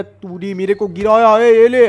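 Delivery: loud shout swinging the blow; angry shout. Acted. Knocked down, shouting back at the thief. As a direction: yell it as you hit back.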